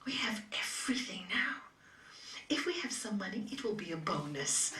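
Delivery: put-on soft loving girlfriend; tender reply. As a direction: gently reassure her fiance. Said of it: "she said".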